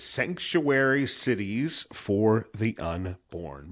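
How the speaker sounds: website name spelled out slowly, word by word; slow, deliberate announcement. Real, not acted. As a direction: give listeners time to catch each word. Repeating website name for listeners.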